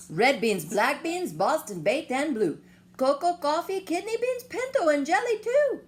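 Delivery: loud, rising list chant; excited bean list. Acted. Reciting the list of beans.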